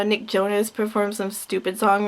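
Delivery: ordinary talk, nothing marked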